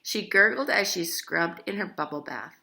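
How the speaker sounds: plain reading voice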